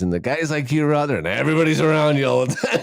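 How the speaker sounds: quoting the doctor through laughter; amused retelling of the doctor's words; laughing quote. Retold or imitated. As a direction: keep laughing as you quote him. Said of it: "And he goes".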